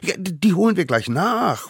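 put-on high stammering child voice; eager promise. A narrator's reading. Little savages promise the court an apology, eager and stammering. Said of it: "versprachen die kleinen Wilden".

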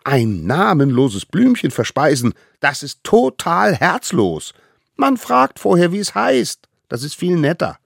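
high, loud scolding; indignant little hunter. Acted. Little hunter scolds the mammoth, high and indignant. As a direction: tell him off like a tiny teacher.

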